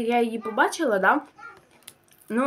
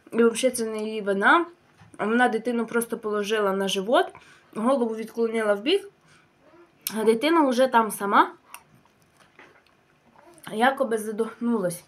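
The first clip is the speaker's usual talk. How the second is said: talking with spoon at lips; speaking around a spoon; spoon at mouth. Real, not acted. Seen 2: spoon held at lips while speaking throughout.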